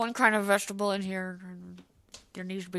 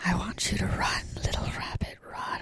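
menacing unvoiced whisper; creepy whisper; 2 semitones lower than usual.

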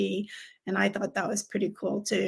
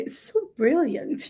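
mock British; 5 semitones higher than usual. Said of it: "but they'll say".

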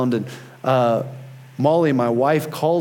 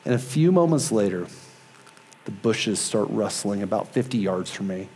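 usual speaking voice

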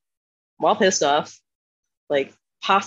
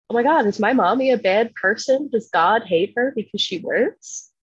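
higher-pitched mock little-girl worry; upset little girl; 5 semitones higher than usual. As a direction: imitate a distressed child, pitched up. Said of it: "little girls start getting upset".

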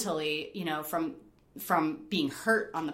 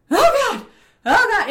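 loud, high re-enacted panic; exaggerated "I'm stuck up high" alarm; panicked outburst; 13 dB louder than usual. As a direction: cry out in mock alarm, far higher and louder. Retells her panicked top-out.

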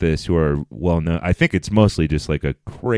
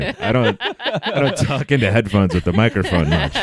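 ordinary delivery

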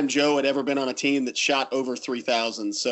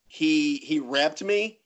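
ordinary delivery

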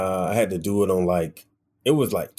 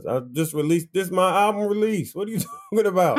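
high, incredulous retort with a laugh; exasperated; 9 semitones higher, faster than usual. Retold or imitated. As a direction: imitate yourself insisting, pitch climbing. Retold exasperated answer to friend.

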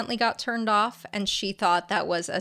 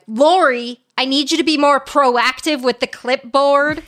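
imitated snippy scolding, loud and raised; snippy boss; 5 semitones higher, 7 dB louder than usual. Imitating a spiritual speaker telling off a helper, snippy. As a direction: order a lackey about, mock bossy. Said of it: "being snippy with their lackeys".

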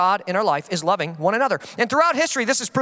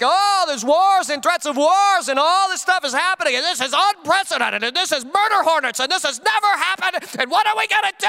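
higher, rushing, mock-frantic worrier; mock panic; 6 semitones higher than usual. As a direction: ham up the panicking believer, higher and higher to the end. Mocking panicked believers.

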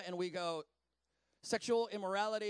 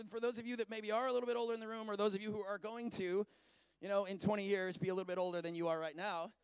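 usual speaking voice

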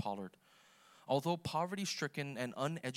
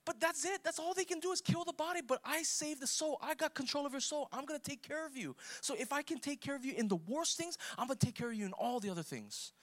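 much higher, excited paraphrase of Jesus; voicing Jesus; 12 semitones higher than usual. Preacher paraphrasing Jesus to the congregation, high and eager. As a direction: speak as Jesus reassuring, fast and lifted. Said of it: "But he's like".

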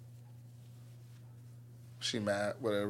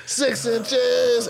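mock drill command; 9 semitones higher than usual. Sergeant ordering flutter kicks, barked mock command.

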